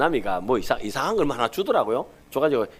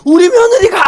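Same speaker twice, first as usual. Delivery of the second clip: much higher, loud shout of joy; imagined shout; 14 dB louder than usual. Retold or imitated. Grandfather in the restaurant, shouting his joy about his daughter-in-law. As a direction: bellow it out in delight. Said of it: "제가 고함 쳤을 겁니다".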